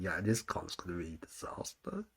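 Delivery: gloomy. Retold or imitated. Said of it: "bad omens, I was like".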